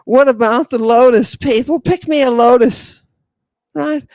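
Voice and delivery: ordinary talk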